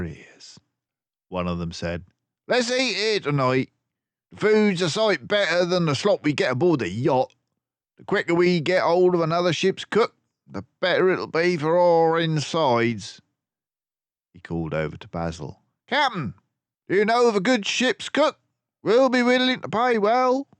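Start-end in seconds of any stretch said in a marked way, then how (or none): 2.5-13.2 higher, louder, fast rough sailor; a swaggering brute griping over lunch; rough sailor thug. A narrator's reading. Thug grumbling to his mates about yacht food, rough and fast. 15.9-20.5 loud, fast, higher rough sailor; thug calling over. A narrator's reading. Thug calling over to Basil for a cook, loud and rough.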